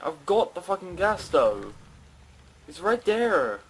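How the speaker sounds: normal talking voice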